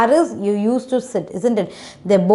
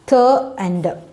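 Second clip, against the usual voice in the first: "The under" split after the opening sound; short "The", tiny gap, then "under"; alternative syllable division. Shown as a demonstration. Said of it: "We can divide it out like this also".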